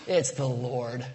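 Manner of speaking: slow, drawn-out reverent reveal; slow awed announcement. Acted. John telling Peter the man on shore is Jesus, slow and awed. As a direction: let the recognition land slowly. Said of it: "I don't know if that's how he said it".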